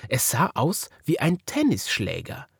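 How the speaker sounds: ordinary delivery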